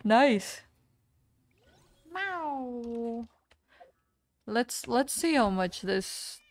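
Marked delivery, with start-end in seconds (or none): 2.1-3.3 soft drawn-out falling mew; long gentle pretend meow; cat meow. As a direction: meow softly like a kitty. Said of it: "I am on my cat form today".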